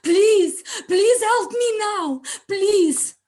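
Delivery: high, loud, crying plea, slowing on the middle please; tearful plea to God. Retold or imitated. A trapped mother crying out to God for help, loud tearful pleading. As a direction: beg God through tears, voice cracking upward. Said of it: "I raised my voice and I pleaded to God".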